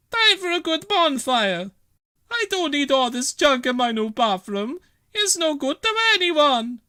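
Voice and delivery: a bustling Italian landlady; Bella's voice. Acted. Bella clearing junk for her new bathroom, brisk.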